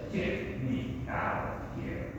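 quiet urgent plea under breath; embarrassed whisper. Acted. Girlfriend to Herod, embarrassed whisper. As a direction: whisper it so no one hears.

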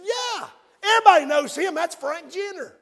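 high, loud acted exclamation; emphatic recognition. Pastor's acted emphatic reply of recognition.

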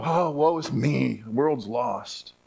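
a hint of a despairing believer, slowing down; mock despair. Preacher mocking a believer's despair, mild mock lament. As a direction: mope like the world is ending, lightly. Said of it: "we could just go".

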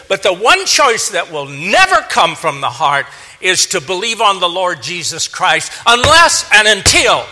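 loud preacher's voice, swelling on the last words; forceful preaching. Real, not acted. Preacher warning the congregation about the heart's choice, forceful preaching. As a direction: preach it hard, building to a ringing close.

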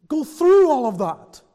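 through punched hard and loud; emphatic. Acted. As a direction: hit "through" with force, then fall. Emphatic end of question series.

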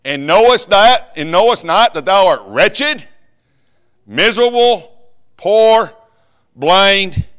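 loud, high, slow hammering of each word; preaching at full force. Acted. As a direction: drive the list home like a fiery preacher.

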